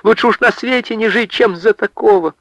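high, insistent pleading; high, distressed lament; unrelenting protest. A narrator's reading. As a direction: argue on with father, high-pitched. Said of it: "не унималась Анка".

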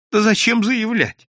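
high, plaintive bitterness; voiced character's bitter resignation; bitter complaint. A narrator's reading. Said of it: "с горечью произнёс голос регистратора".

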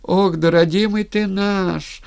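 high, loud keening cry; wailing old woman. A narrator's reading. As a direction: launch into a funeral wail. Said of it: "Опять завыла было Кликуша".

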